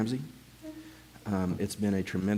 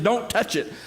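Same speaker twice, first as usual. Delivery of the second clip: urgent warning; 11 semitones higher than usual. Retold or imitated. Repeating the warning.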